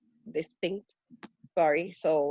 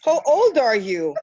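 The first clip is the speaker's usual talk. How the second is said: high, loud startled huh then a louder question; surprised disbelief; 7 semitones higher, 5 dB louder than usual. Retold or imitated. People hearing her age, stunned and a bit louder. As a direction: act out a stranger caught off guard by her age. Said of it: "And they're like".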